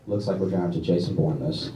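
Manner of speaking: very low, hushed tough-guy voice; mock action hero. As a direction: play the grim hero under your breath. Acted action-hero inner resolve.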